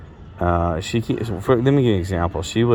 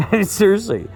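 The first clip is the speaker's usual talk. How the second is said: real laugh; 13 semitones higher than usual. Real, not acted. Laughing at brother's remark.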